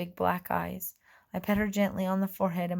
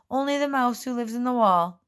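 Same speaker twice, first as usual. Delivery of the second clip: louder, higher nervous fib; loud bluff; 5 semitones higher, 6 dB louder than usual. A narrator's reading. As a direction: fib again to fool him. Fairy bluffs again.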